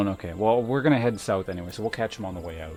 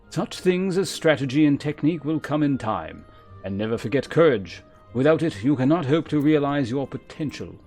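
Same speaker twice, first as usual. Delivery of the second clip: formal, measured mentor with deliberate emphasis; lancer guildmaster; 4 semitones higher, 8 dB louder than usual. Acted. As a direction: instruct a pupil with grave authority. Voices the lancer guildmaster's dialogue.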